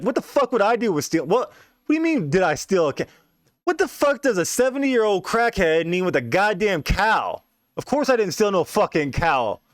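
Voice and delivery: put-on high, indignant old man protesting; hammed-up high outraged old crackhead. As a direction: squeal it out like an accused old man.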